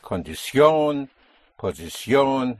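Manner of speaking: Condición, posición each drawn out and hit on the final CIÓN; stress on last syllable. Shown as a demonstration. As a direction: slowly push the endings of Condición and posición. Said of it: "you will find an accent over the O".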